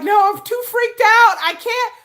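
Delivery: high, loud mock panic; mock freaked out. Refusing to message the man back, high mock panic. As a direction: squeal that you can't do it. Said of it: "I'm like".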